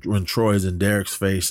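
normal talking voice

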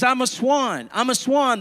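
high, delighted storybook exclamation; lightly acted happy outburst; happy cry. A narrator's reading. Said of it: "cried so happily".

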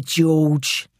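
voiced, taunting London man; intruder's voice. Intruder calling to the hidden man, taunting voice. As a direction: call the name out as a villain. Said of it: "said the first voice".

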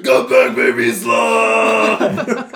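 playful call after a sloth; drawn-out yelled call; mock scream. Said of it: "I would totally scream that".

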